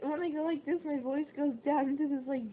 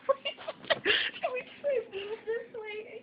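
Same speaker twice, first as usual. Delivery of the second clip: high, whimpering real plea; distressed plea; 8 semitones higher, slower than usual. Real, not acted. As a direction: plead slowly in a high, tearful voice. Disoriented, asks to move away.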